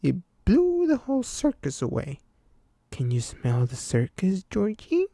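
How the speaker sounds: high creepy clown voice; Pennywise. A narrator's reading. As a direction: coax him like a grinning clown. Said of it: "Pennywise continues speaking".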